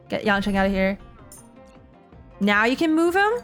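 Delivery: ordinary talk, nothing marked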